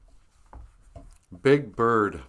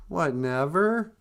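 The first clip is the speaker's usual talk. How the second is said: slow, higher, rising innocent question; Big Bird; 5 semitones higher, slower than usual. Retold or imitated. Big Bird puzzled by death, slow naive question. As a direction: draw it out in puzzled disbelief. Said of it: "Big Bird's all like".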